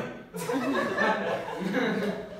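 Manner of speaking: loud, high laugh at his own joke; loud laughter. Real, not acted. Comedian laughing after the German [name] joke, loud. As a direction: crack up loudly.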